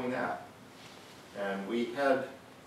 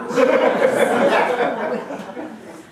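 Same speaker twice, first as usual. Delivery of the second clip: airy high laughter; 13 semitones higher, 9 dB louder than usual. Real, not acted. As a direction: laugh out loud. Teller laughing at his story.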